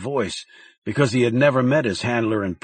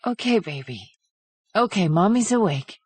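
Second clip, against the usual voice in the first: soothing mother; 8 semitones higher than usual. A narrator's reading. Mother calming child.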